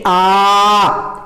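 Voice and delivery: long, steady "आ" held well past normal; drawn-out vowel. Shown as a demonstration. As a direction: stretch "आ" out slowly and evenly. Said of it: "थोड़े खींच के पढ़ेंगे". (खींच के लंबा "आ")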